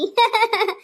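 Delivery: amused laugh. Acted. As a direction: laugh along to cover up. Joking that everyone will enjoy it.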